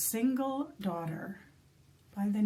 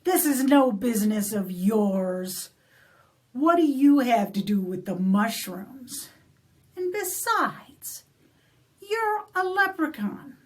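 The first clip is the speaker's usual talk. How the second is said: grab him and demand the gold, getting louder; angry demand; 4 semitones higher, 5 dB louder than usual. Acted. Cranky Molly shaking the leprechaun, loud angry demand. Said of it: "And she says".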